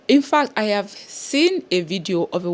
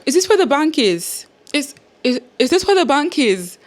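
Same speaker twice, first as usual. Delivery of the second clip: incredulous question; 7 semitones higher, 6 dB louder than usual. Retold or imitated. Retelling her baffled question to staff.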